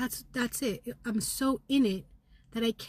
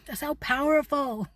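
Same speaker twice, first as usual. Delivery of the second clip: higher, louder burst of power; proud outburst; 5 semitones higher, 5 dB louder than usual. Real, not acted. Telling viewers how powerful she is, a loud proud burst. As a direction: declare your power. After the whisper.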